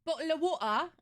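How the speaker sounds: high, loud mock British accent. Streamer parodying the British for viewers, in a mock British accent. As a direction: caricature British, punched up high. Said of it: "American's idea of a British person".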